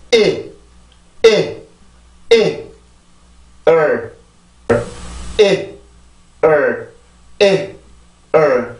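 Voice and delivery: 이 and 을 as bare vowel beats, consonants left out; rhythm demo. Shown as a demonstration. English teacher drilling a word's rhythm, isolated vowel beats. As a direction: beat out just 이 and 을, one separate syllable at a time. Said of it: "이게 이 단어의 리듬입니다".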